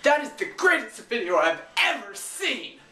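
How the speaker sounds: high, loud over-the-top enthusiasm; mock rave. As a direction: gush sarcastically like an overexcited fan. Sarcastic echo of the top comment.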